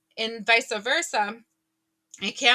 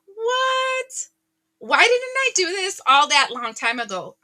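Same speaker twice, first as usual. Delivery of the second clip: high, wailing What? then louder; stunned outcry; 9 semitones higher, 3 dB louder than usual. Retold or imitated. Viewer amazed the offer just landed, crying out. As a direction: cry out in happy disbelief. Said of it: "you're like".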